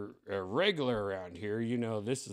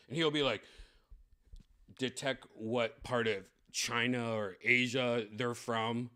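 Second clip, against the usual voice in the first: normal talking voice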